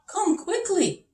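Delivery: high, loud frantic cry of a worried king. Acted. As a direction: call out urgently as the panicked king. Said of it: "he cried".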